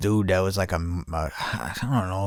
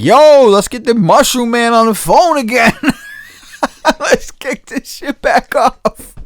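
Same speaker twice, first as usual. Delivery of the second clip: high, louder, laughing call; excited laughing; 12 semitones higher, 4 dB louder than usual. Retold or imitated. Eager to get the mushroom man back, laughing excitement. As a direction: holler it giddily, breaking into laughter.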